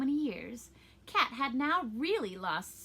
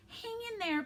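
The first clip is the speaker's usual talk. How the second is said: put-on high boy voice; 12 semitones higher than usual. Pinocchio voiced in read story.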